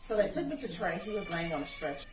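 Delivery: normal talking voice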